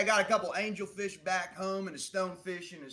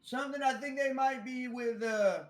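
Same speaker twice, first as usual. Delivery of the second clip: higher, louder hurried calling; calling across; 9 semitones higher, 4 dB louder than usual. Acted. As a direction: call it out fast across the shop. Clerk calls orders across shop.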